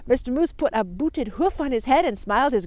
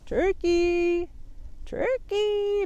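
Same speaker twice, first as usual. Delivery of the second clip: higher sugary coaxing call; sweetest voice; 10 semitones higher, much slower than usual. Acted. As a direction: sweetly lure the turkey out. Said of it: "called in his sweetest voice".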